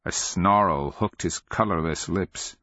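ordinary delivery, nothing marked

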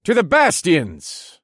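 loud, high, drawn-out toast; rousing toast. Acted. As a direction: lead the table in a toast. Hero raises a toast.

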